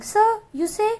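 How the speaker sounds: incredulous question. Acted. Ant questioning the cricket.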